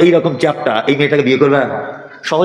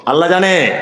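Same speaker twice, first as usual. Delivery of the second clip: usual speaking voice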